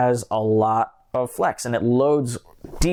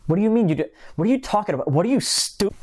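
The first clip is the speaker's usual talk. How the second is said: higher-pitched mock indignation, cut off; mock intense; 7 semitones higher, much faster than usual. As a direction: fire back in pretend outrage. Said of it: "I got a little intense there".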